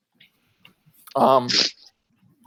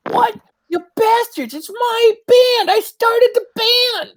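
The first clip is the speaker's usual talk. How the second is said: much higher, slow squeaky outrage of a small halfling; betrayed halfling; 3 dB louder, slower than usual. Acted. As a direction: wail it like the band was stolen from you. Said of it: "he looks around like".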